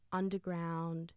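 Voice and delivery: usual speaking voice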